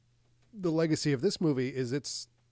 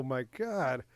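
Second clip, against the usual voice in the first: ordinary delivery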